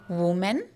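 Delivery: Woman said as wu-man, the singular sound; mock the mistake: Woman with an oo sound; wrong woman for plural. Shown as a demonstration. Teacher imitating students' wrong plural. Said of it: "some people mispronounce it as".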